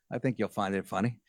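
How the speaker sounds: ordinary talk, nothing marked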